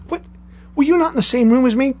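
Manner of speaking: high, fast mock indignation; retold wounded-ego protest, high and hurried; mock incredulous. Retells his indignant comeback to friends.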